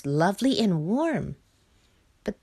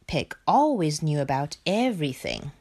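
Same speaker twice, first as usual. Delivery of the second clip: plain reading voice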